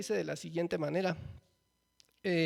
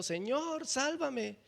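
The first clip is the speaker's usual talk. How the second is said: higher-pitched, understated cry for help; mild cry out; 7 semitones higher, much slower than usual. A narrator's reading. Peter starting to sink calls to the Lord, mildly crying out. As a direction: read it as a restrained calling out. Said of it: "dio voces diciendo".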